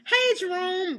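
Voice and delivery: high, loud mock greeting; imitated coworker hailing someone; calling out. Imitates someone calling wrong name.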